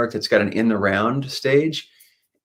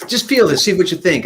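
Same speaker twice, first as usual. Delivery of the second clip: voicing Brad; 9 semitones higher, 4 dB louder than usual. Brad voiced in retold story.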